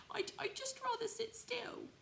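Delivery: very high, stammering toy rabbit; squeaky hesitant little rabbit. Acted. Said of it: "he said".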